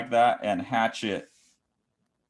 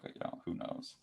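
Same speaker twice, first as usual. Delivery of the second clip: whispered; 13 dB quieter than usual. Real, not acted. Trailing shrug, breathed out.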